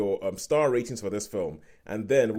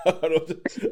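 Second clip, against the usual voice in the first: ordinary talk, nothing marked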